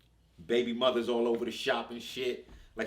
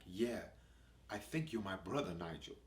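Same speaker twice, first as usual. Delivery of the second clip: put-on quiet, soft polite boy; well-spoken kid, hushed and careful; little posh voice; 5 semitones lower, 9 dB quieter than usual. To Nigel, a quiet posh boy introducing himself. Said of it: "He's got this little posh voice".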